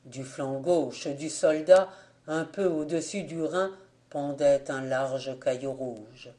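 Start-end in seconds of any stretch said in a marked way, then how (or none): none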